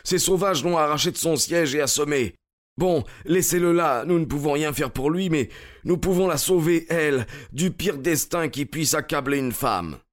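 high, urgent outburst; agitated exclaiming. A narrator's reading. Stranger to rescuers over the wounded groom, agitated exclaiming. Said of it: "s'écria l'étranger".

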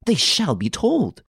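high, slow, emphatic indignation; indignant. A narrator's reading. Woman threatens to tell the police, high indignation. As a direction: insist indignantly, pitched high. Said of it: "she exclaimed indignantly".

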